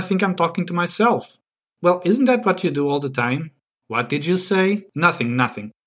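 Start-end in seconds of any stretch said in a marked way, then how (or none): none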